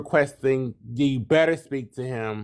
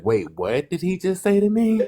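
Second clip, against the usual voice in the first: imitating shock; 8 semitones higher than usual. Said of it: "they be like".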